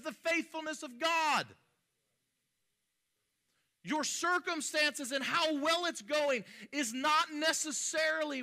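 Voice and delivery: high, loud sermon delivery; forceful preaching. Real, not acted.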